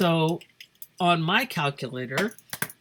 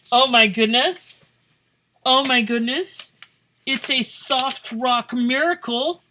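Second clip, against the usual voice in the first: delighted surprise; 7 semitones higher, 5 dB louder than usual. Real, not acted. Finds a dry paper.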